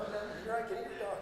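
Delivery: wife refusing. Retold or imitated. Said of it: "If she had continued to say".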